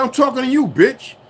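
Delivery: high, loud confrontational shout; retold yell. Telling off a cop in the Bronx, loud retold yell. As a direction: yell back at the cop, defiant.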